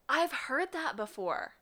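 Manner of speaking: high, gasping surprise; excited gasp. Real, not acted. Gasping at a familiar color.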